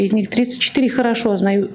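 ordinary delivery

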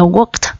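"worked" as one syllable ending in T; teacher's model "workt" for "worked"; final T sound. Shown as a demonstration. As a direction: close "worked" on a crisp "kt". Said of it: "âm T, âm thờ".

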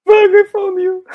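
high, loud frightened shriek; being chased. Real, not acted. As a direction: scream it as she jumps you. Caught by killer in game.

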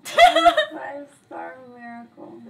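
loud exclamation. Acted.

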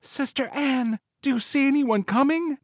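very high wifely voice, held back; soft call to sister. A narrator's reading. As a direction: call to your sister without shouting, high-pitched. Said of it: "again called softly to her sister".

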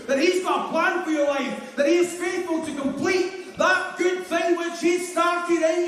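high, driving revival preaching. Real, not acted. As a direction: preach it like you feel it. Preaches at full force.

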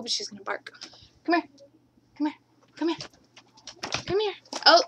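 usual speaking voice

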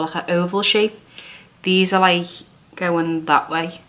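damp mask on face; talking while adjusting mask; through a sheet mask. Real, not acted.

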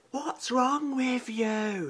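imitating a young girl, high and drawn out. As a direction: drag it out like a sulky teenage girl. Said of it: "Like a young sort of girl saying".

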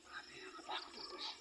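scared whisper. Real, not acted. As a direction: breathe it out, frightened. Whispered fear after scare.

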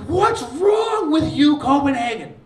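high, loud, slow furious shout; angry yell. Retold or imitated. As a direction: yell at the whole crowd. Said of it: "I got pissed".

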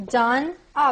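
usual speaking voice

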